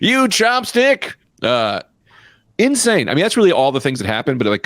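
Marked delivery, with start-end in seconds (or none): 0.0-1.1 mock insult. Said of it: "put her fist in when she went".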